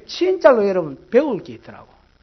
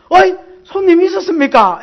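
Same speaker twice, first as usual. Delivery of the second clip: loud, high sarcastic comeback; the waitress snapping back, loud and high; snappy retort; 9 semitones higher, 8 dB louder than usual. Retold or imitated. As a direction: throw it back at the customer, sharp and loud.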